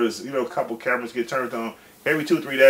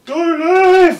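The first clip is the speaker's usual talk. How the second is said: much higher and louder yell; shouted slogan; 12 dB louder than usual. Retold or imitated. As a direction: belt it out the car window. [name] leaning out yelling at press.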